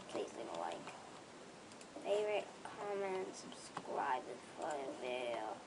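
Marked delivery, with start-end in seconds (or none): 0.1-0.8 hushed lower whisper; joking whispered outro. Acted. 3.3-5.6 hushed lower whisper; joking whispered outro. Acted.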